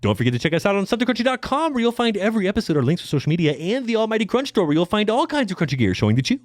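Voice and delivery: fast, upbeat promo voice; ad read. Acted. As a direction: rattle off the plug like an ad. Podcast plug read.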